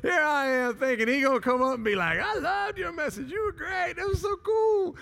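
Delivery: very high, loud comic rant; hammed-up gushing. Pastor imagining praise he expected, hammed-up and high. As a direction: squeal the praise in mock excitement.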